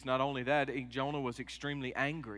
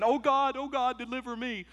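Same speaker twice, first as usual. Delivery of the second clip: high, loud mock cry for rescue; desperate Jonah plea; 10 semitones higher, 5 dB louder than usual. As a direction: beg like a drowning man. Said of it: "Jonah praying in the fish".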